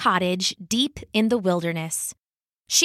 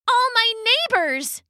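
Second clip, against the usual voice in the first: high distraught cry; 12 semitones higher than usual. Acted. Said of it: "she said".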